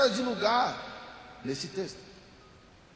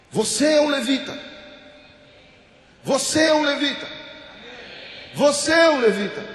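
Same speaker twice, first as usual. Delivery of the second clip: louder with each repeat; loud proclamation; 5 dB louder than usual. Real, not acted. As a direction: preach it out to the room. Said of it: "repeated declaration to congregation".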